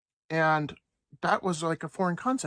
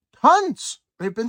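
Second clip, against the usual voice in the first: loud, much higher burst on one word; emphatic outburst; 8 semitones higher, 10 dB louder, slower than usual. Real, not acted. Vlogger ranting that the Fed was wrong, loud outburst. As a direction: blurt it out in disbelief.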